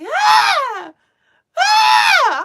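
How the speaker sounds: put-on shrill piercing child scream, very high and loud. Imitating the screaming child heard while meditating. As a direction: let out a child's wailing scream. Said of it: "a child screaming".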